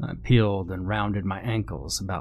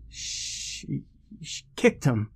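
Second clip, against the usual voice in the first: faint false start, then higher; nervous stammer; 5 semitones higher, 4 dB quieter, much slower than usual. A narrator's reading. Shaken friend explains the accident to newcomer, stammering. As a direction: stammer it out weakly, voice pitched up. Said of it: "Peter stammered".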